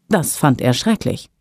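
ordinary delivery

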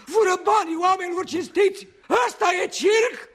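high, outraged exclamations; indignant outburst. Acted. Old clown rails at the crooks, indignant. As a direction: cry out against the swindlers. Indignant rant about swindlers.

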